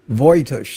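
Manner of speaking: Voitush said as VOY-tush; corrected name pronunciation. Shown as a demonstration. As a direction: Voitush with OY in the first syllable. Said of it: "It's pronounced Voitush".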